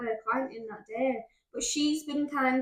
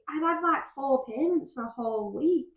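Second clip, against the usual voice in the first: ordinary talk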